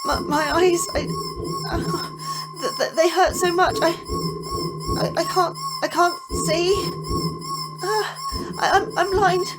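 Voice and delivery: crying, broken, pleading not to die; sobbing in terror. Acted. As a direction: beg through sobs, blinded and terrified. Blinded, begging, crying.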